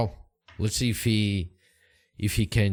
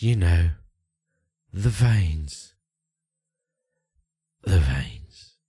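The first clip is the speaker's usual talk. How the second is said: dying elf; 3 semitones lower, 6 dB louder, slower than usual. Acted. Dying elf's last words.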